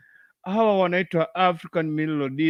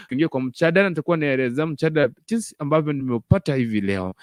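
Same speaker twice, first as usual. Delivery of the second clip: ordinary talk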